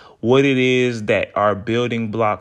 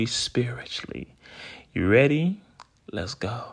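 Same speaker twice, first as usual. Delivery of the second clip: guided meditation; slower than usual. Acted. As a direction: ease them into calm breathing, slowly. Leads a breathing exercise.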